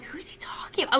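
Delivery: whispered to yourself, puzzled; hushed, wondering who; retold confusion, whispered under the breath. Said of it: "I'm like".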